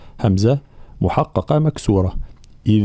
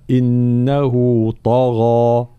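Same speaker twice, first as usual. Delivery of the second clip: very slow recited طغى, full heavy ta and ghain; Quran recitation; much slower than usual. Shown as a demonstration. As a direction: recite it slowly with heavy letters. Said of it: "في قوله تعالى". (تلاوة بطيئة مفخمة)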